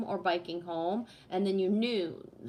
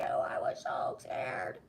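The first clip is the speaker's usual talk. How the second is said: lower, slow, rasping; hoarse whispered yell; 8 semitones lower, 4 dB quieter, slower than usual. Retold or imitated. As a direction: hiss it out as a furious whisper. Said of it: "a grown-ass adult saying".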